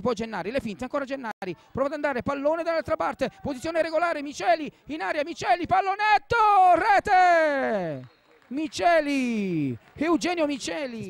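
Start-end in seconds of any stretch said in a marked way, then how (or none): 2.2-11.1 excited goal call. Real, not acted. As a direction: lift the voice and yell the goal. Goal call in live commentary.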